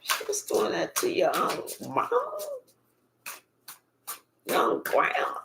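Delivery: unvoiced, hushed shock at the grandma; a hushed, appalled whisper; whispered disbelief. Real, not acted. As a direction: whisper it like you can't believe it.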